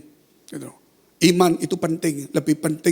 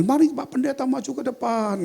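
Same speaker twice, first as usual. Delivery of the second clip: lightly acted announcer, raised pitch; a hint of an MC's call; host calling up; 8 semitones higher than usual. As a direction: invite the guest forward in a high, sustained call. Host calls preacher to the front.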